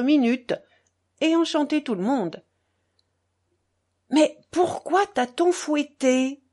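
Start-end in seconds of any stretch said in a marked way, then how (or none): 4.1-6.4 raised, slow, incredulous question; half-yelled question; mildly yelled. A narrator's reading. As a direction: call it out high and drawn-out. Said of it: "hurla Kalganov".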